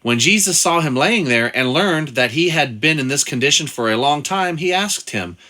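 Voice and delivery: Bible reading. Acted. Reads the Bible passage aloud.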